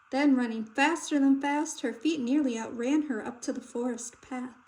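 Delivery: plain reading voice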